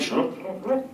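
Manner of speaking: shushing. Retold or imitated. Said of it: "And I'm like".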